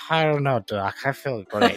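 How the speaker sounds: woozy, slurred patient on palm wine; drunk patient. Acted. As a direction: slur it happily, half sedated.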